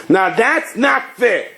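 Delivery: slow, high-pitched objection; mock protest. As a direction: object indignantly, drawing it out high. Voices objection to the Rebbe's deal.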